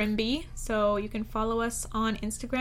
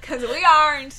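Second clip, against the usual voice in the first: laughing; 4 semitones higher, 10 dB louder than usual. Pretend. Repeats the joke line, laughing.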